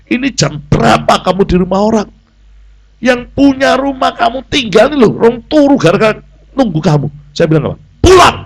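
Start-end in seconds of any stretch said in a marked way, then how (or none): 0.1-7.1 higher-pitched reproach, not shouted; mildly indignant telling-off; retold scolding. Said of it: "wis tak bentak". 8.1-8.4 high, loud one-word command; sudden retold scolding shout; barked order. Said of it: "wis tak bentak".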